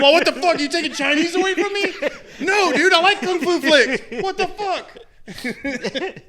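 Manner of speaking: put-on loud, high, outraged old man ranting. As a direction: rant like a grumpy old man, laughing through it. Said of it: "I'm just going on old man rant time".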